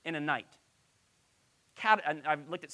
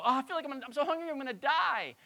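overdramatic; 7 semitones higher, 3 dB louder than usual. Retold or imitated. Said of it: "we tend to be very dramatic and go".

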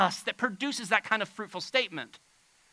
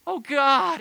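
high, loud mock-penitent plea; hammed-up remorseful "Oh, God"; put-on sorry; 7 semitones higher, 8 dB louder than usual. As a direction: overdo a sorry-sounding cry to God. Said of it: "We try to sound sorry".

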